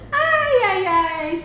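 high squealing laugh into ai; helpless laugh breaking into a cry of ai; laughing hard. Real, not acted. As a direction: crack up until it squeaks. Laughs telling the story.

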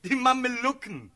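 high, loud sarcastic outburst; exasperated shout. Acted. Friend to confused cavalry commander, exasperated shout. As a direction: fling the answer back at full volume.

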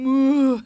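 loud mock moo; cow mooing. Teacher answers children with cow sound, loud mooing.

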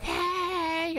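high, called-out hey; calling out hey. Shown as a demonstration. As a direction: shout it as if from far away. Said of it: "Just be like".

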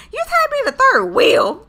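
high, loud incredulous question; taken aback. Acted. Friend reacts to third wheel confession, loud and incredulous. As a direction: echo it back in loud disbelief.

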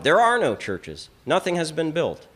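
ordinary talk, nothing marked

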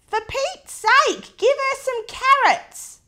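high, loud fed-up shout; exasperated yell. Acted. Mother sick of the wombat, shouting loudly. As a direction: cry out in exasperation. Exasperated human voiced in story.

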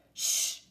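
unvoiced shush; hushing shh. A narrator's reading. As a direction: shush the pirates. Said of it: "I said".

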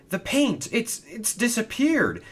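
very high, stammering panic; high-pitched, acted dismay; alarmed. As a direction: falter in shocked disbelief. Hero discovers the paint is missing.

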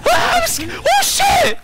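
very high, loud yelp; startled scream. Real, not acted. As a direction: scream out in sudden fright. Scared by game, screaming.

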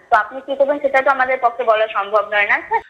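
ordinary talk

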